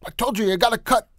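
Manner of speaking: high, loud, fast barking order; Jocko's take on a gruff barking coach. Shown as a demonstration. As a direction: bark the order at her. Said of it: "Give it your go".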